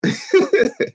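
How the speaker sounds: sudden high-pitched laugh; high squeal laugh. Real, not acted. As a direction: squeal with laughter at your own joke. Laughs at own low blow.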